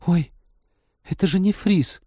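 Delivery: high, gasping shock. A narrator's reading. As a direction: gasp it out, stunned. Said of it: "потрясённо ахнула".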